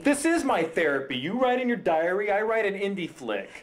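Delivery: high, indignant self-defence; defensive. Acted. Writer defending his film to his ex, defensive.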